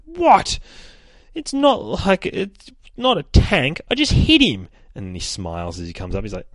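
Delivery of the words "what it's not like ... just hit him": put-on high, loud indignant kid voice; protesting child. Daughter defending herself to mum after a punch, indignant protest. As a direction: protest your innocence in a high little-girl voice.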